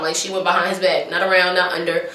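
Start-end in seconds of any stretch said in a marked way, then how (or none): none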